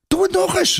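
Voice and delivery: put-on very high, thrilled girl's voice; a girl cheering the boy on; delighted cry. A narrator's reading. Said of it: "roept ze opgetogen".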